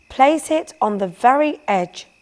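a firm, loud order from the master artist. A narrator's reading. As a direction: give the order to the workmen. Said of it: "Sandro ordered".

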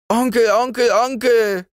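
very high, loud, frightened calling out; a terrified child calling her uncle; trembling cry. Acted. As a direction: cry for her uncle, fighting back sobs. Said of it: "mit bebender, zitternder Stimme".

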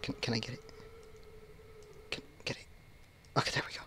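hushed whisper. Real, not acted. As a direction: whisper so he won't hear. Sneaking past monster.

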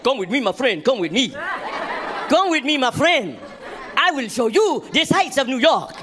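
mock African tour guide accent; hammed-up African street guide voice. Comic imitating an African tour guide hustling him in an African accent. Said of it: "so many Africans coming at me".

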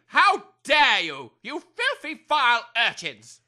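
loud, slow outrage; indignant tirade. Acted. Major scolds the boys.

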